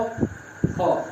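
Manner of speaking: क, ख whispered out with no voicing at all; voiceless consonants demo. Shown as a demonstration. Teacher shows students voiceless क, ख, unvoiced and breathy. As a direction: breathe out क, ख without any throat buzz. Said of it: "कैसे नहीं होगा?".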